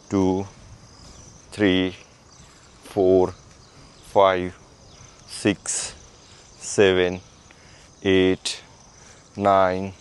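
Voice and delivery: low, level rep count; exercise counting. Acted. Instructor counting shoulder rotations, steady low count. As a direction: count evenly, one word per movement.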